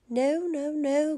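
lightly acted cry of protest. A narrator's reading. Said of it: "cried the little pig".

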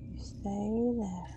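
soft and slow, drawn out; slow, wary murmur; hushed caution. Real, not acted. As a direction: stretch "stay" out quietly, as if creeping away. Slow quiet repeat.

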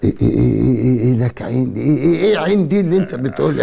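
high, stammered indignation; worked-up, high-pitched challenge; indignant protest. Acted. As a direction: object sharply, pitch climbing. Pained uncle objects to nephew's remark.

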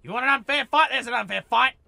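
loud mocking challenge; loud taunt. Real, not acted. Player taunting the raider back, loud and cocky. Taunting enemy after retreat.

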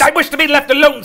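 high, loud, fast rebuff; testy miser cutting someone off; irritated. Acted. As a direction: snap it out impatiently. Scrooge rebuffs the charity collector.

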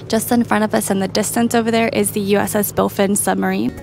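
ordinary talk, nothing marked

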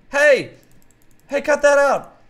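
loud high yell to stop him; shouting. Real, not acted. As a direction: bark at someone to quit it. Yelling at attacker.

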